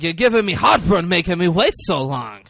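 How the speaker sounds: put-on very high, loud, fussing Yiddish accent; kvetching Yiddish mother accent. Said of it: "And he's like".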